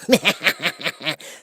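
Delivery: high mocking cackle; a short taunting witch laugh; witch's laugh. A narrator's reading. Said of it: "laughing at her".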